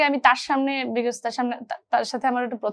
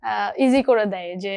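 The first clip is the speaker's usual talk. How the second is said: ordinary delivery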